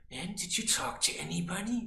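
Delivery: mock threatening mother. Mother quizzes her son, a mild mock threat. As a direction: put on a mother asking with a hidden threat. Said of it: "like a threat".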